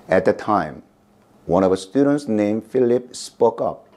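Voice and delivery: ordinary delivery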